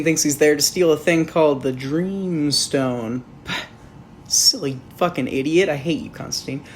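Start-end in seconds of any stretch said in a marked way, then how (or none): none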